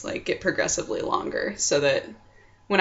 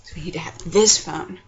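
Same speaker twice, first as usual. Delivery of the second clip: whispering. Real, not acted. Whispering while checking phone.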